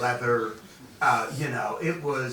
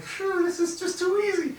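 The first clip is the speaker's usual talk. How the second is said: gleeful. Retold or imitated. Gleeful inner thought.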